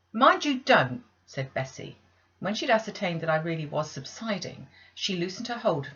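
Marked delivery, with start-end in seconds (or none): 0.1-1.0 loud, high stern warning; sharp warning. Acted. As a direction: warn her sharply. Bessie warning.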